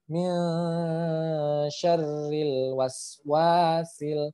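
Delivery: very slow syarril with lam held, not bounced; slow recitation. Shown as a demonstration. Teacher reciting, lam not bounced. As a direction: chant mil syarril slowly. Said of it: "perhatikan panjang pendeknya".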